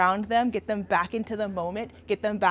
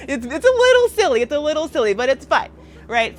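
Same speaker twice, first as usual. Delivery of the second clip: laughing; 4 semitones higher, 8 dB louder than usual. Real, not acted. Laughing at the silly exercise.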